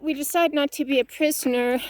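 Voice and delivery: ordinary talk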